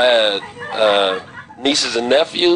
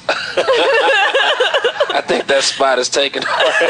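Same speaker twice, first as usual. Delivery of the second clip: laughing; much slower than usual. Real, not acted. Laughing reply.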